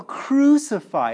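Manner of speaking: loud, drawn-out mock crowd shout; retold crowd yell. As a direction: imitate a crowd yelling for blood. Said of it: "They yell".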